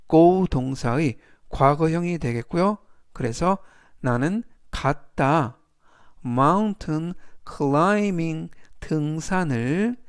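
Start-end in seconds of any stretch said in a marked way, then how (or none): 6.2-8.5 "Mountain climbing" drawn out slowly; slow model reading. Shown as a demonstration. Teacher reading the example phrase to learners, slow and clear. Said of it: "활용 문장 보시겠습니다".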